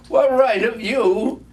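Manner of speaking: high and loud, playful; gaily. A narrator's reading. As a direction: mirror the uncle's words back with cheer. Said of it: "returned the nephew gaily".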